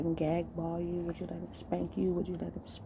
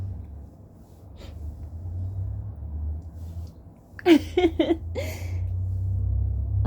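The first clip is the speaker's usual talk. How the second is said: loud, drawn-out laugh; 10 semitones lower, 5 dB louder than usual. Real, not acted. Host laughing while asking ladies, long loud laugh. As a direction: burst out laughing, let it run.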